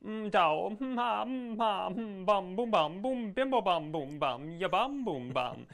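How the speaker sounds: high, bouncy nonsense babble; singsong hums and bum-boom syllables; wordless accent mimicry. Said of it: "if somebody sounds like this".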